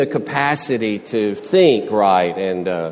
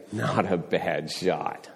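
slow, softer, low-key "Not a bad shot"; dry understatement; 7 semitones lower, 7 dB quieter, slower than usual. Shown as a demonstration. Golfer praises a shot near the hole, dry understated. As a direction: play it cool, praising by understating. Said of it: "a certain way you say something".

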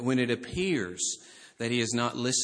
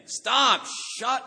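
high, raised-voice scolding; loud rebuke; 11 semitones higher, 5 dB louder, much slower than usual. Acted. Crowd rebuking the blind beggar, acted loud scolding. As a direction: shut someone down forcefully. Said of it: "they were rebuking him".

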